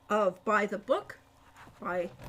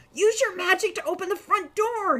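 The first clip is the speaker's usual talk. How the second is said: a raised, urgent order with a hint of hoarseness; hoarse order; 8 semitones higher, 5 dB louder than usual. A narrator's reading. Hawk orders Al to open the door, hoarse and urgent. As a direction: push the command out through a tight throat. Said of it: "he said hars-, hoarsely".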